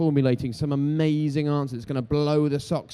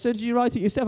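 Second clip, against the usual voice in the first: much higher, sing-song mock of a nosy fan; mocking question; 9 semitones higher than usual. Fans doubting he wrote his book, much higher and mocking. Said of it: "Everyone goes".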